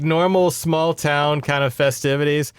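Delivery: ordinary talk, nothing marked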